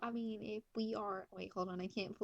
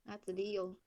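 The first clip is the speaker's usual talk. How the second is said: ordinary delivery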